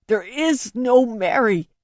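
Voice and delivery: a touch of a scream at her; mild scream. Acted. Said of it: "he screamed at me".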